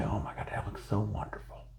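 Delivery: breathy, hushed mock praise; softly imitating a gushing viewer; gushing admirer, whispered. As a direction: whisper it like an awestruck fan. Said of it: "And people say".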